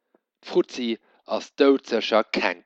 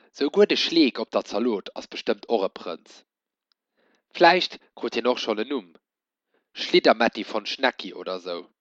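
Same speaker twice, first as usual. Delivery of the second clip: usual speaking voice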